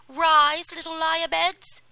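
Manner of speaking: loud, high, harsh wake-up call; wicked stepmother. A narrator's reading. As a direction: bark them awake, high and loud. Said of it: "she said".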